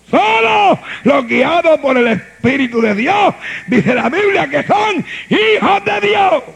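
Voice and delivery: loud, driving sermon voice; full-force preaching. Acted. Pastor preaching hard to his congregation, full-force preaching.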